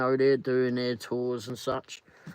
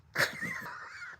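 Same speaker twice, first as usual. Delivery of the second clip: high helpless laugh; 10 dB quieter than usual. Real, not acted. Losing it over the joke.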